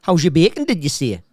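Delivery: sing-song Geordie catchphrase; Geordie accent. Retold or imitated. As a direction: belt it out in mock Geordie. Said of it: "in the Geordie accent".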